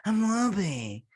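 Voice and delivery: high, slow put-on Whoopi voice; Whoopi impression. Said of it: "they should get Whoopi in here".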